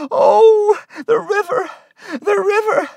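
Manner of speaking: very high, loud, anguished wail; crying out. A narrator's reading. Martha cries out beside the dark river, anguished wail. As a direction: wail in desperate distress. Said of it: "She began to cry".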